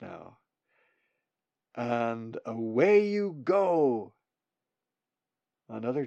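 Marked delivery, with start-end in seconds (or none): 1.8-4.1 gentle encouragement. Acted. Coach sending bird off.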